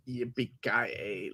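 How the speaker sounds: slow, low, quiet drawn-out catchphrase; quoting the catchphrase. Retold or imitated. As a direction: stretch it out low and soft. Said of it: "Oh, when he says".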